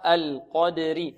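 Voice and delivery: القدري very slow, with the kasra ra kept thin. Shown as a demonstration. Teacher shows a thin ra on القدر to the class. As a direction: stretch القدر and keep the ra light. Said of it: "والراء هنا ترقق".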